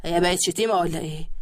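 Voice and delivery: a husband teasing his sulky wife; husband's voice. A narrator's reading. (زوج بيتريق بصوت واطي)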